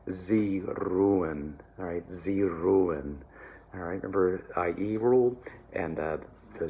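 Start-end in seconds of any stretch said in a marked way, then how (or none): 0.1-1.5 slow, careful German; German diction demo. Shown as a demonstration. Choir coach modelling the phrase, slow careful German. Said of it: "the most beautiful part of all".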